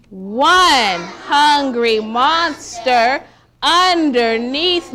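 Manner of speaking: slow, loud rhythmic read-aloud; reading to children. Acted. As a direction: read the rhyme slow and bouncy for kids. Reads rhyming big book aloud.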